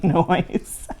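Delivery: ordinary delivery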